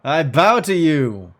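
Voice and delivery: booming German-tinged giant; hearty German roar. Acted. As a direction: roar with delight, bowing grandly. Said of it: "raucous belly laugh".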